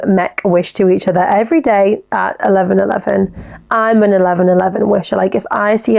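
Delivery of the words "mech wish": usual speaking voice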